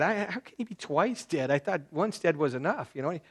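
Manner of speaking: high, loud, fervent sermon delivery; impassioned preaching. Acted. Preacher thundering at the congregation about dead trees, fervent. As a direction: thunder it from the pulpit, drawn out.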